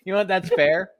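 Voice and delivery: loud laughing concession. Real, not acted.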